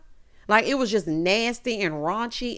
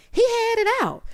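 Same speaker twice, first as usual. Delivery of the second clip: mock scandalized gossip; 13 semitones higher than usual. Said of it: "talking about".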